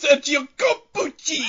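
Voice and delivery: high, exaggerated Italian sing-song; sing-song gusto. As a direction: throw yourself into it, mockingly over-the-top. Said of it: "do it in a sing-song way".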